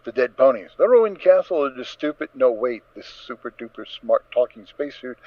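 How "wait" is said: usual speaking voice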